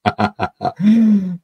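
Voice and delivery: high burst of laughter; high laughter at the joke. Real, not acted. Laughs at comment.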